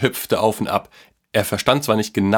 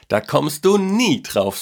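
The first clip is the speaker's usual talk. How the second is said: put-on high, drawn-out boyish tease; boy's teasing voice; 7 semitones higher, slower than usual. A narrator's reading. Boy teases grandpa with his secret, high and drawn-out. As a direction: stretch it out high, sure of your secret. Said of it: "sagte der Junge".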